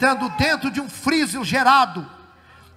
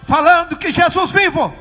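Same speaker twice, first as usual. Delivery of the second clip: loud, high revival proclamation; full-force preaching; 9 semitones higher, 10 dB louder than usual. Real, not acted. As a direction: shout the good news to the church. Proclaims to the church.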